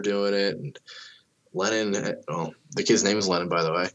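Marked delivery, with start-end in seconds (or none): none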